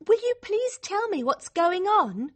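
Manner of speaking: high, pleading demand; exasperated bewilderment. Acted. Confused girl begs Liz for answers, exasperated. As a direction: insist on an explanation.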